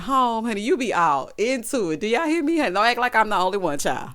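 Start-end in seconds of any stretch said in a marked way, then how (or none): none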